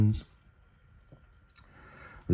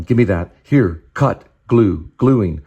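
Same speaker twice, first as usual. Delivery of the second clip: loud, hurried commands over a patient; urgent paramedic orders; 7 dB louder, slower than usual. Acted. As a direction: bark orders like a medic in a rush.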